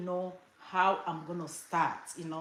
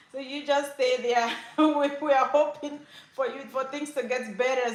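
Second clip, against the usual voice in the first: higher, louder playful imitation of mom; voiced mother; 5 semitones higher, 6 dB louder than usual. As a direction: implore like a mother, on the edge of laughter. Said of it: "My mom will say".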